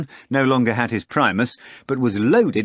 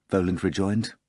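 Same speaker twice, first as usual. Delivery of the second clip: usual speaking voice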